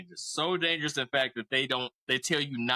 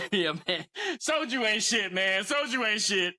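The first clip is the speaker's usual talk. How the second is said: higher, bigger soju ain't shit; louder drunken brag; 6 semitones higher than usual. Retold or imitated. Big friend on the second soju bowl, louder drunken brag. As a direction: push the brag up, getting rowdy. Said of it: "He gets more and more aggressive".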